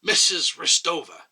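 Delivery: ordinary delivery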